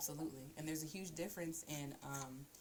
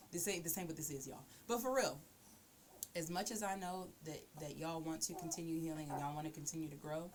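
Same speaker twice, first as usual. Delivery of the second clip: ordinary delivery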